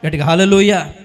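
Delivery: shouted hallelujah to the congregation; loud hallelujah. Real, not acted. Preacher leads the church in a loud hallelujah. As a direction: call it out with full force. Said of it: "స్తోత్రం చెప్తాం గట్టిగా ఒకసారి".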